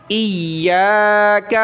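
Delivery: ایاک with the یا doubled and stretched, slow; drawn ya. Shown as a demonstration. Teacher correcting the reading, ایاک with the یا stretched. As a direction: hold the یا long in ایاک. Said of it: "اس کو کھینچنا ہے یا کو".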